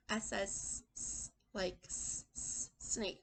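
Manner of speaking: "s" as a long hissing sss; drawn-out s like a snake. Shown as a demonstration. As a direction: hiss the "s" like a snake. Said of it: "it even sounds like a snake".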